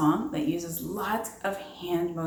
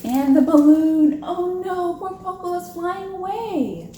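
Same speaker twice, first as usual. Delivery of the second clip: higher, louder alarmed reading; dramatic storytime alarm; 10 semitones higher, 7 dB louder than usual. Acted. Reading to kids as Poco floats away, alarmed. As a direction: gasp it out like the balloon is escaping. Dramatic read of flying balloon.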